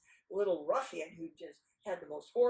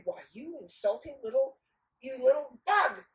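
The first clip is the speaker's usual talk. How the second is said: offended ladies, raised voice; hammed-up outrage; indignant scolding; 6 semitones higher, 9 dB louder than usual. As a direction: splutter the insult, higher and louder. Insulted ladies scold him.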